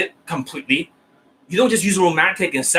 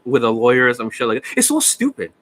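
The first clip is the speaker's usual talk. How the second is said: ordinary talk, nothing marked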